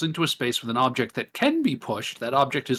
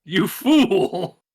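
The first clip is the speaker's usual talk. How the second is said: hammy villain, louder; villain taunt; 5 semitones higher, 4 dB louder than usual. Acted. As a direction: sneer it like a cartoon villain. Villain taunt after move.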